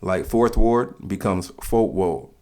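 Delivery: "Fourth Ward" drawled out as "Folk Ward", slowly; slow demo turning "Fourth Ward" into "Folk Ward"; New Orleans dropped letters. Shown as a demonstration. Explaining New Orleans speech to viewers, slow "Folk Ward" demo. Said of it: "several letters are dropped from certain words".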